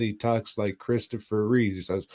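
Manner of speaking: usual speaking voice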